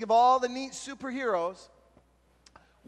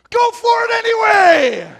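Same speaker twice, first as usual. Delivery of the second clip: much louder and higher, slow; shout; 11 semitones higher, 15 dB louder than usual. Retold or imitated. Youth pastor urging his nervous champion on, big loud shout. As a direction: belt it out as a rallying cry.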